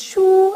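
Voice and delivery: "shu" said with a high level tone; Mandarin shu. Shown as a demonstration. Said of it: "the character for book".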